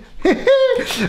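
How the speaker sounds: short, very high giggle; squeaky little laugh; high-pitched giggle. Real, not acted. Mocking the broken trick.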